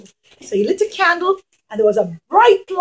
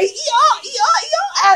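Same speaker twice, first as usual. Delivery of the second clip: high hee-haw bray; donkey bray; 9 semitones higher, much slower than usual. Pretend. Teacher showing the children the donkey, high bray.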